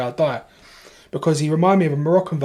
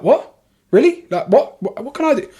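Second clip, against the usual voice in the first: sharp high incredulous questions; frantic and thrown, voice jumping up; panicked disbelief; 9 semitones higher, 4 dB louder than usual. Retold or imitated. Answering his partner in a frantic panic, high incredulous.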